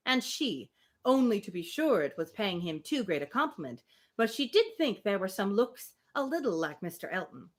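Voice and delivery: steady, measured reading of the novel; story narration. Acted. Reading Emma aloud to a friend, measured narration. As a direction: read the Austen passage aloud, even and clear.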